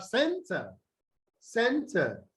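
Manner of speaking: CENter, with a weak schwa in "ter"; center, strong start and reduced ending; first-syllable stress. Shown as a demonstration. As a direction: lean on "cen", let "ter" drop. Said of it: "stress on the first syllable".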